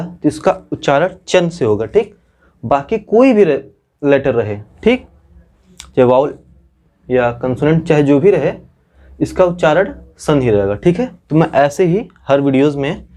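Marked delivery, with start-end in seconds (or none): none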